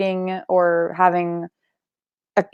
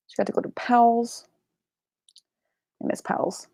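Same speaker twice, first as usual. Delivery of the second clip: wistful whisper; 3 semitones higher, 7 dB quieter than usual. Real, not acted. Longing for a bookstore.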